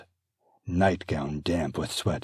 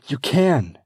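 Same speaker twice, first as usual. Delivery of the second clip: higher, firm reassurance; gentle but firm coach; reassuring midwife; 10 semitones higher, 10 dB louder than usual. Acted. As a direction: encourage her. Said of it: "I can't".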